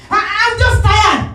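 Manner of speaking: high, loud, drawn-out complaint; mock wail. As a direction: exaggerate a worn-out daughter's wail. Daughter's acted complaint on phone.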